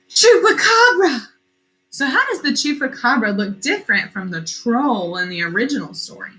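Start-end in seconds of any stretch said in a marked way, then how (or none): 0.1-1.3 high, scared cry of the name; frightened cry. A narrator's reading. Little goat spotting Chupacabra, frightened cry.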